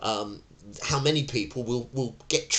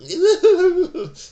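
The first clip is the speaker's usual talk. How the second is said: bright laugh; 12 dB louder than usual. Real, not acted. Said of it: "laughs at own story".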